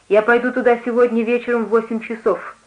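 plain reading voice

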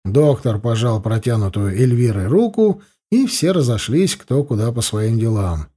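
plain reading voice

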